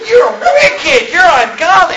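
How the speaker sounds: high, loud, wailing accusation; mock outraged cry. Friends condemning the speaker, wailing outraged shout. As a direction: shriek it like a horrified church kid. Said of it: "all my friends just flipped out".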